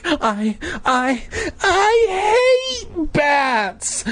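high, tearful drawn-out wail; stammer, then stretch it into a squealing cry; hammed-up terrified stutter. A narrator's reading. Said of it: "I stammered".

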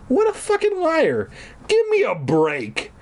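high-pitched mock indignation; angry cry. A narrator's reading. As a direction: protest high, then drop. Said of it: "I cried angrily".

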